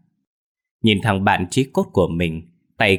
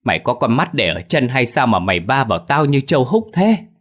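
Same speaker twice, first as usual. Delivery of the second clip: high, loud cry of pain and outrage; pained angry shout; groaning yell; 5 semitones higher, 4 dB louder than usual. Acted. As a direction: yell at the clumsy thug, hurt and furious. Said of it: "đang nằm la rên rỉ".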